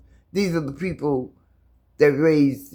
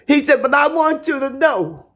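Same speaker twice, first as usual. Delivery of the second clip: high, loud fervent sermon voice; preaching; 8 semitones higher, 5 dB louder than usual. Real, not acted. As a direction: preach the scripture with fire. Preaching continues.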